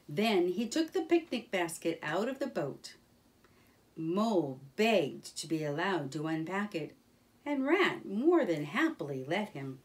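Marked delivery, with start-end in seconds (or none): none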